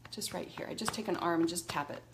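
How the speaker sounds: ordinary talk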